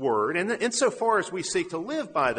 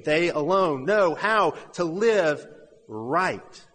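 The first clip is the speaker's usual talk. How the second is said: slow, louder restatement of a quote; emphatic quotation; 3 semitones higher, 4 dB louder, slower than usual. Retold or imitated. Preacher repeats a pagan's quote to the church, slow and emphatic. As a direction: drive each word home slowly, with a pause before the last.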